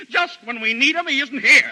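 high, loud complaint; frustrated shout. Acted. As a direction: throw up your hands and yell. Frustrated outburst.

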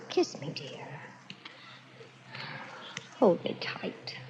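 soft intimate whisper. Acted. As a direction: breathe it close to his ear. Bride asks for embrace, unvoiced.

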